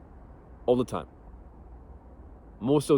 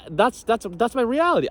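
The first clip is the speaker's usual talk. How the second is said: ordinary talk, nothing marked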